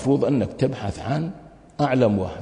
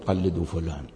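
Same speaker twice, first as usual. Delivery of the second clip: "قلدوا فلان" repeated much lower and softer, in disbelief; incredulous quiet echo; 7 semitones lower, 10 dB quieter than usual. Retold or imitated. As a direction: echo the phrase under the breath, questioning it. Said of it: "أنت إيش عليك تقول". ("قلدوا فلان" بصوت منخفض وخافت باستنكار)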